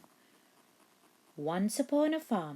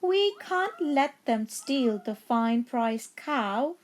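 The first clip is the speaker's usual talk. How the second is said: higher, slow, meowy cat voice; meowing cats; 8 semitones higher, 6 dB louder, slower than usual. A narrator's reading. The cats protest the theft, slow and meowing. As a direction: stretch it out like two cats. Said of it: "both the cats began to meow".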